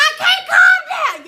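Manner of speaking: high, loud panicked cry; panicked yell. Retold or imitated. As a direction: yell it like you're losing it. Panicking in handcuffs, cousin calming her.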